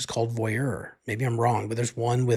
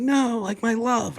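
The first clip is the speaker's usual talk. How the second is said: high-pitched imitation of a frantic wail; half-play a hysterical protest at normal volume; mock freak-out; 12 semitones higher, slower than usual. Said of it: "she's freaking out".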